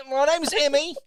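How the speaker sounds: put-on very high, loud parody British accent; mock British accent. Mocking co-host offering her British accent, exaggerated British. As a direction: squawk a terrible British accent. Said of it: "Do you want me to do my British accent?".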